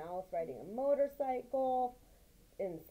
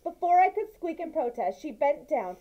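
lively, raised read-aloud voice for the mouse tale; story narration; 4 semitones higher, 9 dB louder than usual. A narrator's reading. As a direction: tell it like a bedtime story, with energy. Continues reading the story.

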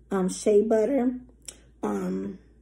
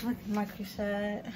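normal talking voice

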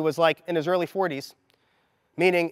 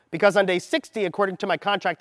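normal talking voice

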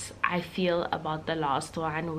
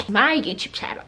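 sassy comeback; 4 semitones higher, 5 dB louder than usual. Retold or imitated. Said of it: "And I'm like".

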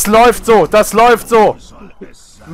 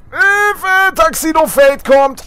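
high, urgent cry for help; panicked call; 12 semitones higher, slower than usual. Real, not acted. Warning teammates of an enemy coming, panicked.

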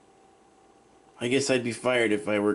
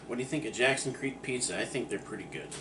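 usual speaking voice

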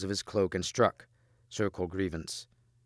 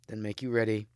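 plain reading voice

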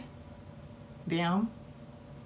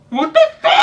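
high shocked squeal; 5 dB louder, much faster than usual. Real, not acted. Disbelief at story.

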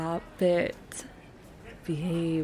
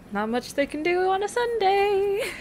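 giddy joke; 11 semitones higher, 4 dB louder than usual. Real, not acted. Joking, pitched up.

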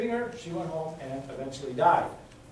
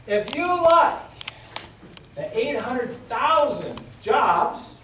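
sarcastic; 6 semitones higher, 5 dB louder, much slower than usual. Acted. Sarcastic campaign taunt.

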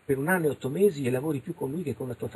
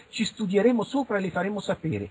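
lightly mimicked official brush-off, higher; mock investigator; 8 semitones higher than usual. Investigators putting off the lady, lightly mocked higher voice. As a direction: imitate putting off a witness. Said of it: "fanno le indagini".

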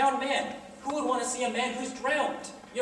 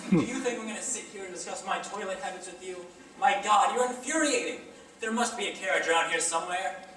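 usual speaking voice

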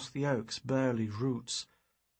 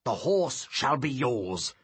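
louder, slow, heavy concession; grudging sorcerer; 5 dB louder than usual. Acted. Sorcerer giving up the stallion, slow grudging declaration. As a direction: hand over the horse with bad grace.

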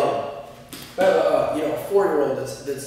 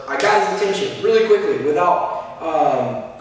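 normal talking voice

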